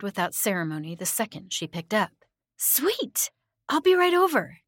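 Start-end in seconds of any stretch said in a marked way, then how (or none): 2.6-4.6 put-on very high, chipper girl voice; bubbly, sweet squeal of agreement; chirpy. A narrator's reading. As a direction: chirp it back eagerly, pitched way up. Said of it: "Linda chirped back".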